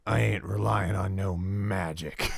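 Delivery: slow, surly bounty hunter voice; curt NPC drawing out the words. Acted. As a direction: play a dismissive tough-guy bounty hunter. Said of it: "you meet this bounty hunter dude".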